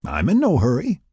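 high, amused lilt; smiling. A narrator's reading. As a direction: say it through a teasing smile. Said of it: "still smiling".

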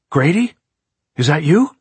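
high, loud, rising questions; urgent calling out. A narrator's reading. Locked-in man calls to the caretaker outside, urgently. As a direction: call eagerly through a shut door.

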